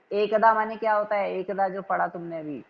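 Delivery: ordinary delivery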